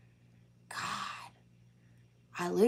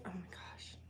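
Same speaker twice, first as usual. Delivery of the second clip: whisper; 22 dB quieter than usual. Real, not acted. Sudden surprise at shedding.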